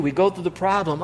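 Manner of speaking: ordinary talk, nothing marked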